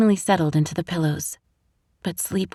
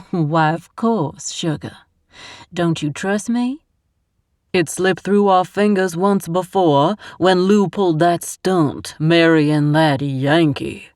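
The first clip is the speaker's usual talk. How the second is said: slow, louder honeyed Southern schemer; sweet as syrup, rotten underneath, Southern accent; scheming Southern mother; 5 dB louder, slower than usual. A narrator's reading. Constance's voice in the scene.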